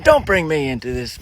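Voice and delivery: normal talking voice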